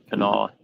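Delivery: "Kanawha" with the W swallowed, nearly ka-NAW; Kanawha without the W. Shown as a demonstration. Correcting the W, barely voiced "Kanawha" with no W. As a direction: say "Kanawha" soft and quiet, dropping the W, ka-NAW-la. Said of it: "they don't really use the W".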